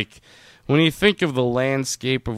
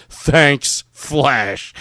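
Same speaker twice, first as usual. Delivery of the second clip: louder, punched words with a pause between; mock proclamation; 4 semitones higher, 6 dB louder than usual. Riffing on the skywritten message, mock proclamation. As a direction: announce it in curt, emphatic chunks.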